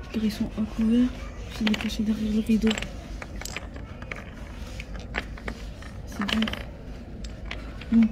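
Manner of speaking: hushed whisper from a hiding spot; whispering while hiding. Real, not acted. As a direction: keep it low so nobody hears. Hiding in a cachette.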